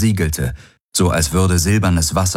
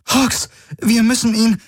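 much higher, urgent call. A narrator's reading. Ki urges Hawks amid the attack, urgent. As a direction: press the team to act.

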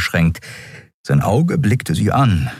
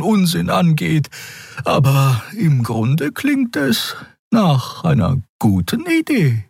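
measured Xea. Acted. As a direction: give a careful opinion, high and unhurried. Voices Xea giving measured opinion.

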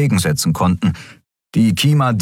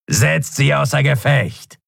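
higher, slow villain order; commanding call; 8 semitones higher than usual. A narrator's reading. Shadow Skria ordering his people to attack, commanding. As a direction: order your troops in a raised pitch.

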